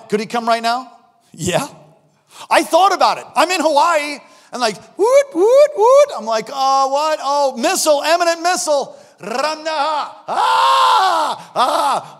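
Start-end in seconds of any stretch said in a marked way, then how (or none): 5.0-6.1 high, loud alert whoops; rising, piercing "woot" alarm noises; imitated siren. Imitating the phone alert going off. 6.5-9.0 raised, loud mock panic; startled, louder and higher; acted alarm. Re-enacting reading the missile alert. 9.2-12.2 high, loud hammed-up "aah"; drawn-out, high panicked cry; mock panic scream. Re-enacting panic at missile alert.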